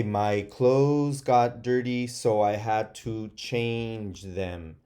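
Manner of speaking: slow, careful sentence read; teacher reading a model sentence slowly. Shown as a demonstration. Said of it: "you could say".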